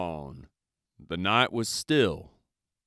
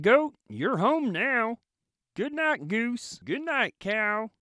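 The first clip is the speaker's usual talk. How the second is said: much higher gentle truck voice; high goodnight truck voice; Little Blue saying goodnight; 13 semitones higher than usual. A narrator's reading. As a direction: tuck each friend in like a kind truck. Truck says goodnight to animals.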